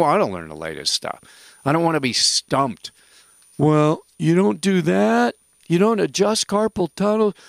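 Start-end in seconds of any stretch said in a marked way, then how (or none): 3.6-7.3 mock critic. Mocking a narrow-minded practitioner.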